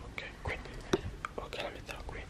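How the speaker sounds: hushed whisper, setting up quietly. Real, not acted. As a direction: whisper so the sleeper stays asleep. Setting camera beside sleeping friend.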